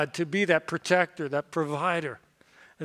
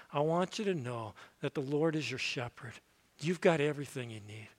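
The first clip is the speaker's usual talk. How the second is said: softer, lower, gentle; tender reassurance; 4 semitones lower, 8 dB quieter than usual. Acted. Pastor comforting the grieving, soft and tender. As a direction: speak it softly to someone grieving.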